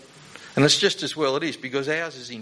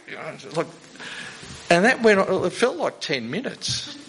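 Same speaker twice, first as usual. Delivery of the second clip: usual speaking voice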